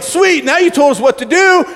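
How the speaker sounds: hammed-up happy approval; excited kids. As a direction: cheer as a youth who's been won over. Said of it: "and they're like".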